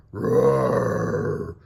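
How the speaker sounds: loud growling roar; mummy roar. Acted. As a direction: monster attack.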